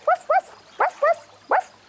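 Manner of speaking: high, playful puppy woof; happy woof. Pretend. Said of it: "do a happy woof".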